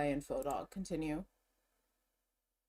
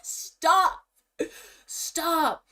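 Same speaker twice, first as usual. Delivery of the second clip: much louder, high shouted stop; shouting stop; 8 semitones higher, 7 dB louder than usual. Real, not acted. Vlogger shouting stop at the new merch reveal. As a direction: yell it in delighted shock.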